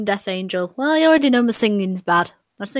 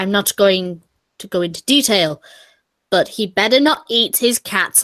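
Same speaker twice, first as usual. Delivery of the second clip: ordinary talk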